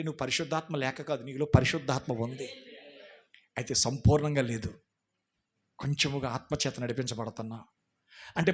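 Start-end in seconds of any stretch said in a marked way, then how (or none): none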